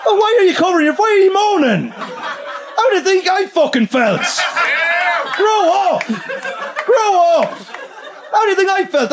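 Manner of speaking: high, exasperated telling-off; indignant.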